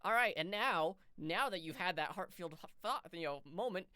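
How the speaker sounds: mock game prompt.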